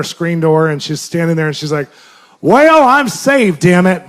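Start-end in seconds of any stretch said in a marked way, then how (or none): 2.4-4.0 slow, loud, gruff mock grandma; angry grandma. Grandma at the screen door, slow loud mock anger. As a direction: bark it out like a cranky old woman. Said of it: "And she looks so angry".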